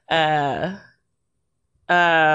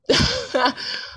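high laugh. Real, not acted. Bursting out laughing.

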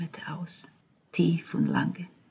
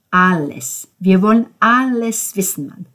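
loud, higher, forceful; insistent demand; 4 semitones higher, 10 dB louder than usual. Acted. Ben insisting Cesco tell all, loud and forceful. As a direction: hammer every word.

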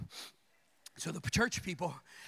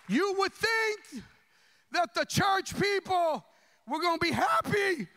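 rising preacher build; 11 semitones higher, 4 dB louder than usual. Real, not acted. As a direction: stretch it out to build the point.

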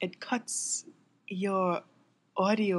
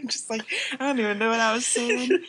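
higher, louder, breaking up with laughter; real laughing; 5 semitones higher, 7 dB louder than usual. Real, not acted. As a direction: lose your train of thought laughing. Lost train of thought, cracking up.

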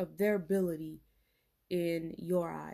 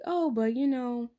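mocking skeptic; 7 semitones higher than usual. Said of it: "some people might think like".

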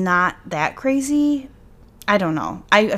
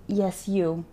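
usual speaking voice

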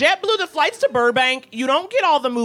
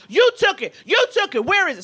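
higher, urgent accusation; mock the man's frantic accusing; 7 semitones higher than usual. Older man accusing the woman, higher and urgent. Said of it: "the man starts going like".

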